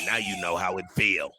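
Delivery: low, softened mock fan reaction; imitating Atlanta fans. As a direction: voice the whole city, low and quiet. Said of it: "all of Atlanta went like this".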